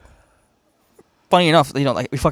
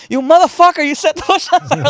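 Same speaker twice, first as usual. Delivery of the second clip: high, loud imitation of a furious mother; mock outraged scolding, pitched way up; outraged mom; 14 semitones higher, 9 dB louder than usual. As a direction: yell it like a mom who just found out. Retold mom discovering he set fire.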